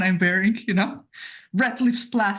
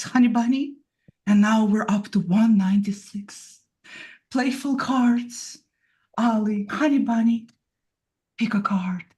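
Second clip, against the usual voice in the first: sexy voice. Pretend. Showing off a tarot deck to Crowley, seductively. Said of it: "Use your sexy voice. Let's hear it".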